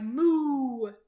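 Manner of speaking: loud lowing moo; cow moo. A narrator's reading. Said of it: "Cow cried".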